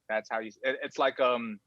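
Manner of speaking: ordinary talk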